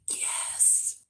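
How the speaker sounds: breathy whispered reply, barely audible; whispered yes. Retold or imitated. Responding to the voice treatment, a blissful whisper. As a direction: whisper it as if melting with relief.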